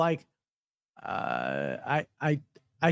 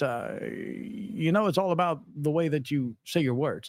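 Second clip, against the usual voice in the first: halting presidential impression, higher-pitched; Obama; 5 semitones higher, 6 dB louder than usual. Host's first attempt at a president, tentative impression. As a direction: try out a president's measured, hesitant delivery. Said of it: "What about an Obama?".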